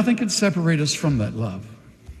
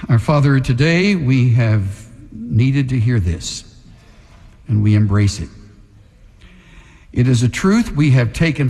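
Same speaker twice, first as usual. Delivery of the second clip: prayer; 8 semitones lower than usual. Acted. Pastor leading the congregation in prayer, earnest.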